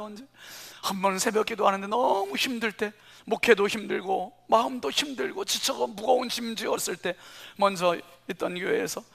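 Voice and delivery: ordinary talk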